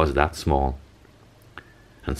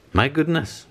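high startled exclamation; mock alarm, pitched up; cried out; 11 semitones higher than usual. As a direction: gasp it out in shock. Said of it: "she cried".

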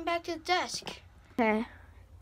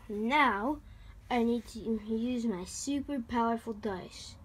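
ordinary talk

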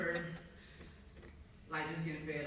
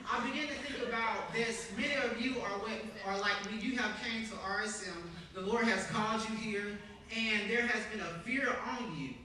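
loud, raised preaching cadence; full-force preaching; 6 semitones higher, 6 dB louder than usual. Acted. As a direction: drive the point home to the congregation.

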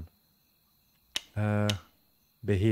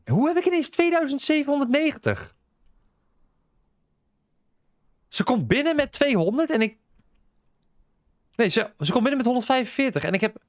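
baffled; 10 semitones higher than usual. Real, not acted. Confused about sudden money.

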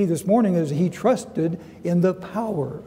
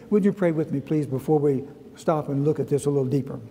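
normal talking voice

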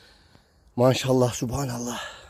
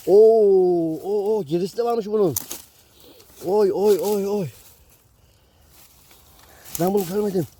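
excited; 5 semitones higher, 4 dB louder than usual. Real, not acted. Excited mushroom find exclamations.

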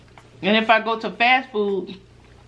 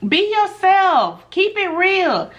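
much higher, slow and drawn out, mock-preachy; mocking people's advice; 10 semitones higher, much slower than usual. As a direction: parrot the advice like a sing-song sermon. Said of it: "you know how people be saying".

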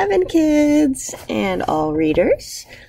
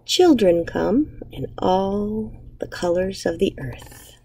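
put-on reading to children. Teacher reading a picture book aloud to her class, gentle storytelling. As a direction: savour each image for young listeners.

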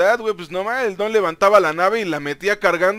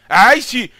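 excited; 8 semitones higher, 11 dB louder than usual. Real, not acted. Thrilled at lifting the ship.

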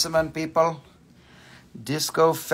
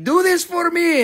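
bossy customer; 13 semitones higher, 6 dB louder than usual. Retold or imitated. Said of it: "and they're like".

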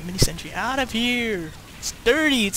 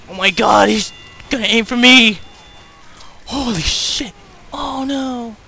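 panicked yell; 2 semitones higher, 8 dB louder than usual. Real, not acted. Heavy about to shoot him.

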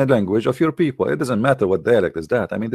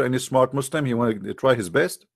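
ordinary delivery

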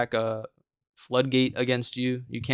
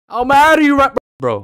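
mocking shout; 13 semitones higher, 11 dB louder than usual. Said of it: "I was exaggerating a little bit for a video".

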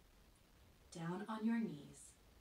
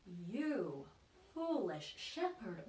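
normal talking voice

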